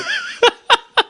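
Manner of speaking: high incredulous burst of laughter. Real, not acted. Son laughing at his dad's brush-off, incredulous. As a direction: laugh in disbelief.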